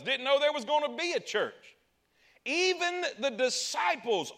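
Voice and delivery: ordinary delivery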